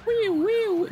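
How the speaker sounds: very high squealing wee; high squeal scattering crowd; playful wee. Pretend. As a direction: squeal like a kid on a ride. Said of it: "you can just go wee".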